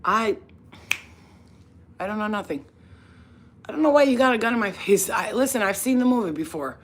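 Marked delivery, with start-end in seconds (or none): 0.0-5.1 loud, high protest of innocence; playing dumb to cops. To the cops pointing a gun, a loud high denial. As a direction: cry out that you know nothing.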